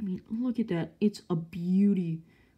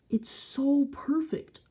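gushing; 7 semitones higher, slower than usual. Real, not acted. Gushing over figure.

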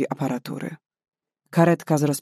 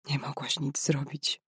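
much softer, breathy whispered "Nie mogłaś nic zrobić."; 10 dB quieter than usual. Acted. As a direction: whisper it to herself, drained. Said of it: "szepnęła".

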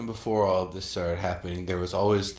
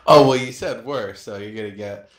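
ordinary delivery

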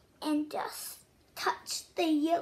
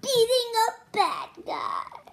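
louder and higher, playful; excited; 5 semitones higher, 5 dB louder than usual. Acted. Loud playful excitement, announcing the game. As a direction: sell the fun with energy.

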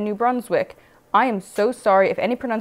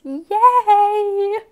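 cheering; 9 semitones higher, 4 dB louder than usual. Real, not acted.

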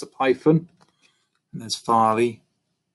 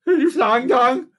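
cries climbing ever higher into a long wail; frantic repeated cries; 5 dB louder, slower than usual. A narrator's reading. Monkey beside himself hunting the culprit, climbing cries. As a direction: repeat it higher each time, stretch the last. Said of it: "he cried again and again".

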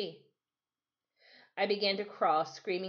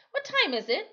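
put-on boy's voice; 13 semitones higher, 5 dB louder than usual. Boy asks Timothy.

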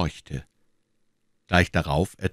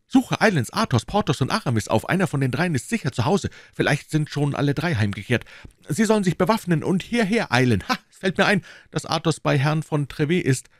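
much higher voice, rushing out commands; hurried orders; 10 semitones higher than usual. A narrator's reading. D'Artagnan ordering his servant to fetch the musketeers, hurried. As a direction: fire off instructions without a breath.

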